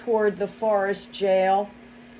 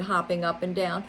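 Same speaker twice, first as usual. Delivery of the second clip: ordinary delivery, nothing marked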